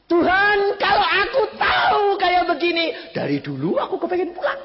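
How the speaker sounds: loud, high exclamation of joy; joyful cry. Retold or imitated. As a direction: call out to the Lord with delight. Wife's voice reported, joyful cry. (seruan gembira keras)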